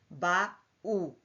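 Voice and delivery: "Ba-u" in two separate beats; syllable by syllable. Shown as a demonstration. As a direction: say "Ba-u" with the vowels pulled apart. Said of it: "pertencem a sílabas diferentes".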